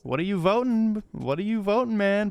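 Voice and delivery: fired-up questioning of the viewer; excited challenge. Real, not acted. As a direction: needle the viewer: which side.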